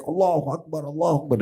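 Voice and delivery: high-pitched mock yell, held back in volume; imitated shout. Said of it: "dia teriak". (meniru teriakan takbir bernada tinggi)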